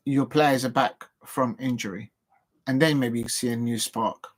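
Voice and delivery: ordinary talk, nothing marked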